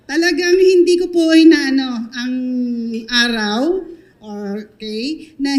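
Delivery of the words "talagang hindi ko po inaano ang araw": laughing. Real, not acted. Laughing, then emphatic vow.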